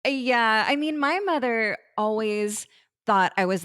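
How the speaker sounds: ordinary talk, nothing marked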